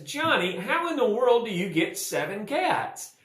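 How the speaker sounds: high-pitched exasperated demand; exasperated teacher. Acted. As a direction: act out a teacher at wit's end, pitch jumping up. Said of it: "The teacher gets all exasperated".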